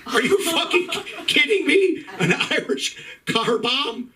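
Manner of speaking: high, incredulous disbelief; mock outrage. Irish comic reacts in mock outrage to the drink name. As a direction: act scandalized at the name.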